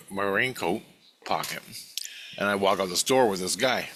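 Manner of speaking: ordinary delivery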